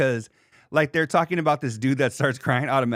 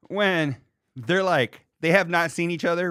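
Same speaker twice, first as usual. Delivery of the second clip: usual speaking voice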